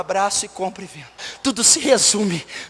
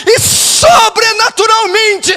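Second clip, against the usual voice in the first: impassioned preaching; 12 semitones higher, 8 dB louder than usual. Real, not acted. Pastor pleading with God for the lost, impassioned preaching. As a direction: preach at full force, stretching the key words.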